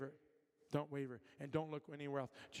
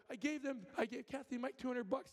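higher, eager retelling; explaining to his wife, pitched up with excitement; eager telling; 8 semitones higher than usual. Retold or imitated. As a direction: blurt it out with glee.

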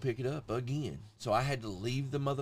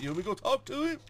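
high, fast, loud echo; imitated eager offer; 12 semitones higher, 5 dB louder, faster than usual. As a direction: parrot the keen friend's rising question. Both mimic Cody offering to confront.

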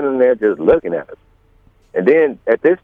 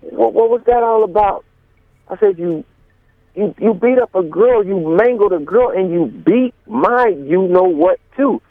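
pitched way up, accusing; retold confrontation; 9 semitones higher than usual. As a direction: call him out for beating a girl. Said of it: "I say".

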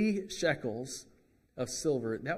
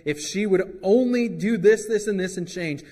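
higher, louder mock complaint; mock blaming husband; 4 semitones higher, 8 dB louder than usual. Husband in a marital dispute blaming his wife, mock exasperated. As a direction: whine about the wife as a fed-up husband. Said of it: "the husband's always gonna say".

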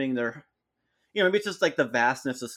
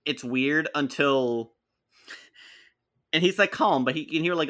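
normal talking voice

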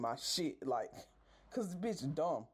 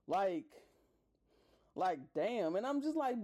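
ordinary talk

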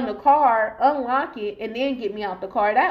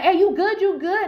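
higher-pitched, worried friend checking in; acted concern, pitched up; urgent checking; 7 semitones higher, 3 dB louder than usual. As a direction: quote a friend urgently making sure. Friends check on her after shooting.

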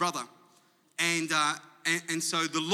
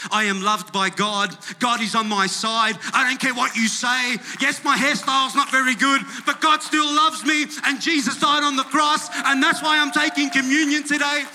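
loud proclamation building higher and higher; defiant declaration; 7 semitones higher, 8 dB louder than usual. Acted. Believer declaring faith while taking communion, building to a shout.

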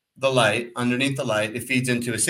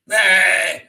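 loud barked yell, pitched up; mock yell; 7 semitones higher, 7 dB louder than usual. Cyclist imitating the trucker yelling at him, loud mock yell. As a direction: bellow it like an angry trucker. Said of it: "starts screaming at me".

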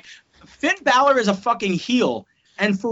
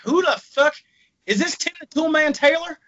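high-pitched mock Texan; baffled Texan crowd; 9 semitones higher than usual. Texas fans puzzled by a wrestler's entrance, high mock Texan. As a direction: ask it as a bewildered bad Texan. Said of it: "hear people going like".

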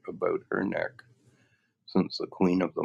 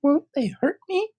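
anxious; 5 dB louder than usual. Acted. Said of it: "asked the girl anxiously".

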